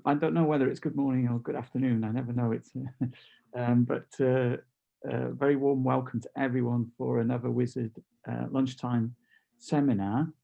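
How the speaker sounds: usual speaking voice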